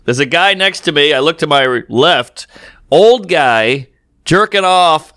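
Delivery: ordinary talk, nothing marked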